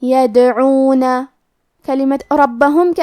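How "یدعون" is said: یدعون with the silent د bouncing in a small echo; slow qalqalah echo on د; qalqalah on dal. Shown as a demonstration. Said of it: "ہم دال کو ہلاتے ہوئے یہ کلمہ کو پڑھیں گے".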